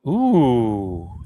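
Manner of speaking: long, loud "ooh" sliding down; loud affronted ooh. Real, not acted. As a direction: let out a big falling ooh. Stung by opponent's bold decision.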